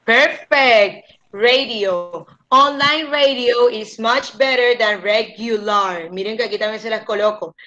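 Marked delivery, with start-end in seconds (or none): 1.3-2.3 "Radio" as ray-dee-oh; English radio. Shown as a demonstration. Teacher confirming the student's attempt, Radio as ray-dee-oh. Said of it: "En inglés se dice radio". 2.5-6.1 slow sentence, radio as ray-dee-oh; careful English model. Shown as a demonstration. Teacher modelling the full sentence, radio and regular carefully enunciated. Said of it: "En inglés se dice radio".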